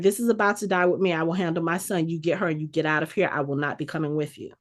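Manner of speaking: grave, hurried instructions before sacrificing herself; Bagra's grim farewell. Retold or imitated. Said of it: "She was like".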